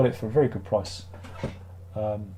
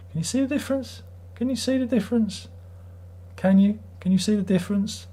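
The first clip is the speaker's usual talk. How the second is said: high insistent asking; 10 semitones higher than usual. Real, not acted. Pleading with viewers to see.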